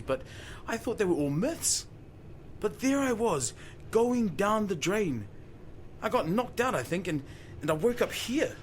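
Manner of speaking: put-on old fisherman rattled by his own tale; agitated. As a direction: tumble it out like he still can't believe it. Retelling the terrifying whirlpool.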